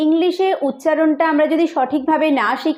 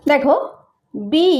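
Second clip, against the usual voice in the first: usual speaking voice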